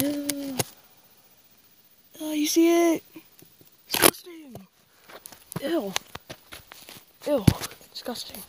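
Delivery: high, disgusted ew; grossed out. Real, not acted. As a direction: recoil from something gross. Grossed out by spider.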